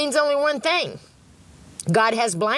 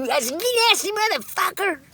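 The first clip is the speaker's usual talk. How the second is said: disgusted ex-husband; 8 semitones higher than usual. Retold or imitated. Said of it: "and he".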